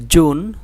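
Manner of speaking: জুন, firm at the start; hard j. Shown as a demonstration. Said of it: "এটা শক্ত". (জুন শক্ত করে)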